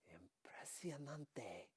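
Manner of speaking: slow, hushed whisper of amazement; awed whisper. Acted. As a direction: breathe it out in disbelief. Awed reaction to escaping ticket.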